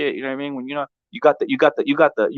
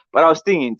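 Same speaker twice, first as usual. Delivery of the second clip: ordinary talk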